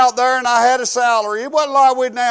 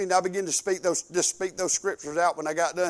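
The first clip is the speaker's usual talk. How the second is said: normal talking voice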